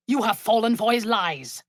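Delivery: ordinary talk, nothing marked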